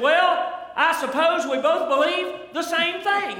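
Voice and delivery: high, slow, drawn-out clueless drawl; puzzled miner. Acted. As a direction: play the dim man who doesn't know. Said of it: "the man pondered a minute".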